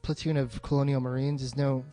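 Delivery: ordinary talk, nothing marked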